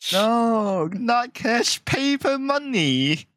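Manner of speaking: high, slow mock customer. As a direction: play the awkward customer. Mocking Hong Kong English.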